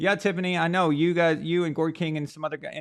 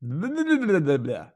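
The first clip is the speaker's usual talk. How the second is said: French-sounding babble in a higher voice, each blah falling; mock French gibberish; 7 semitones higher than usual. His second French impression for chat, hammed-up French babble. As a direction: rattle off fake French like a cartoon Parisian. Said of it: "I have another French impression too".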